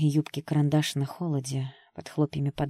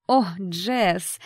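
raised, falsely friendly greeting; forced smile; 3 semitones higher, 5 dB louder than usual. A narrator's reading. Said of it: "попыталась улыбнуться ей Кэти".

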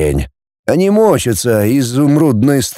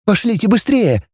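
urgent, much higher voice; hurrying; 9 semitones higher than usual. A narrator's reading. Girl urging her friends onward, hurried. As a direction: hurry them along, pitched up. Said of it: "заторопилась Мальцева".

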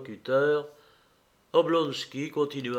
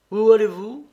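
Oblonsky curieux; 7 semitones higher, 7 dB louder than usual. A narrator's reading. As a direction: question vive, plus aiguë et plus forte. Oblonsky questionne Serge Ivanitch. (Oblonsky demande à Serge Ivanitch où il va, vif)

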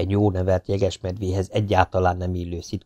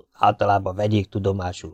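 usual speaking voice

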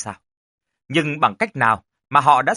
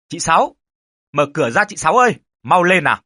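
raised, hurried call through a door; urgent calling; 3 semitones higher, 4 dB louder, faster than usual. Acted. Man calls to Sáu to open the door, urgent call. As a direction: call out higher and fast, urging.

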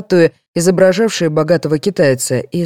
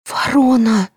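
ordinary delivery

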